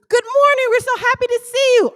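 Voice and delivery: loud, high-pitched exuberant welcome; gushing greeting. Retold or imitated. Cruise staff greeting guests at breakfast, gushing. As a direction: belt out an over-bright staff greeting.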